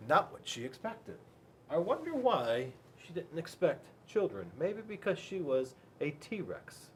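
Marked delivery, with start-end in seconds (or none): none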